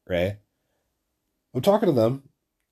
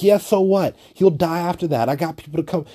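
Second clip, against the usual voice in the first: higher-pitched imitation of defiant bluster; mock her reckless tough talk, pitched up; mimicked cocky bravado; 6 semitones higher, 3 dB louder than usual. Short chick shrugging off getting killed, mimicked cocky bravado. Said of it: "fucking Napoleon complex chick".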